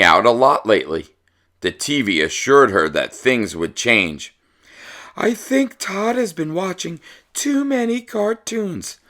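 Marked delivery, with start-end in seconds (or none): 5.2-9.0 high, softer, knowing character aside. Acted. A parent at the conference murmuring a diagnosis, high and soft. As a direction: lean in with a high confiding voice.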